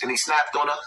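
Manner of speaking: ordinary talk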